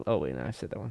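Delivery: ordinary talk, nothing marked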